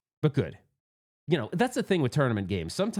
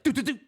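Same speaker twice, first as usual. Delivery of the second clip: quick high-pitched doo-doo-doo; imitated ball bounce; 10 semitones higher, faster than usual. Pinball player imitating the ball in the slings, imitated noise. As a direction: mimic rapid bumps with your voice.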